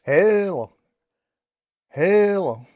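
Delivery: 2 a loud, drawn-out hello into the mic; 3 call into the mic like testing a radio; 1 loud mic check. Real, not acted. 4 keying the CB mic to check watts, loud call.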